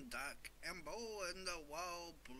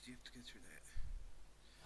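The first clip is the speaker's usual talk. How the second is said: whispering; slower than usual. Real, not acted. Answer given unvoiced.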